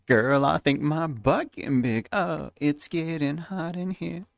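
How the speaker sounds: high put-on Valley Girl voice; Valley Girl accent. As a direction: camp Valley Girl sass. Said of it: "Valley Girl accent when I drink".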